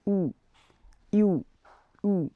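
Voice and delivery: ou said as a plain u; French ou. Shown as a demonstration. Teacher drilling the sound for students. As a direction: repeat ou like you. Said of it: "we will say ou".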